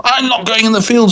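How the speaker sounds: high-pitched indignant protest; hammed-up cross driver. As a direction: refuse in a squeaky outraged voice. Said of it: "got crosser and crosser".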